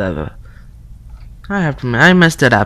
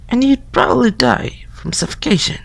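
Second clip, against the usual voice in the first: put-on girl voice whispering; 3 semitones higher, 3 dB quieter than usual. Friend whispering spooky morgue facts through the door.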